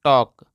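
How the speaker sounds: "टॉक" firm, with silent L; correct pronunciation. Shown as a demonstration. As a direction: answer the drill with "टॉक", no L sound. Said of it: "क्या पढ़ते हैं दोस्तों?".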